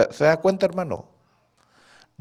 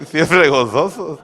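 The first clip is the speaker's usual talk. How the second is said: slow and laughing, a bit higher; laughing exhortation; 6 semitones higher, 6 dB louder, slower than usual. Real, not acted. Preacher urging joy to the congregation while laughing. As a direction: say it through a real laugh.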